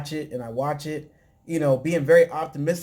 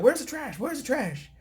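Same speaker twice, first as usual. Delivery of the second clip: much higher, hurried voice of the wife; mock eager wife; 10 semitones higher than usual. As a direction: squeak it out like an eager older wife. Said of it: "So she's over here like".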